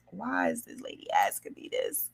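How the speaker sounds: whispered, puzzled inner thought. Retold or imitated. Niece wondering why her aunt keeps asking, whispered. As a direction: whisper it to yourself, baffled. Inner reaction to aunt's invite.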